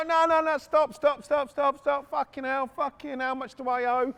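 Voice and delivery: high, loud, frantic pleading in imitation; hammed-up yelps tumbling into surrender; mock panic. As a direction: act out an owner begging them to stop. Owner panics as sparks fly.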